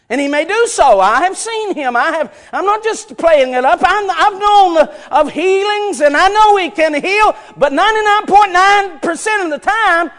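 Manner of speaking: high, shouted sermon with long-held key words; impassioned preacher at full volume; full-force preaching. Real, not acted. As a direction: shout it out to the congregation.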